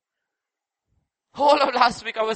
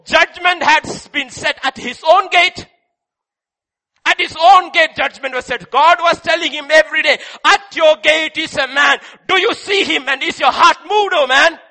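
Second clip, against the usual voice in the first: louder, urgent, higher sermon cry; full-force preaching; 4 semitones higher, 7 dB louder than usual. Acted. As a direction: thunder the warning.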